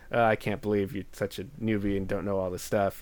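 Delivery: ordinary talk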